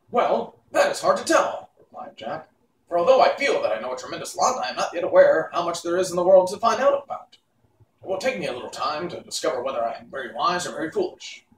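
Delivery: a newborn creature proud of his thoughts; cheerful Pumpkinhead. Acted. Jack the Pumpkinhead answering witch Mombi, eager and fast.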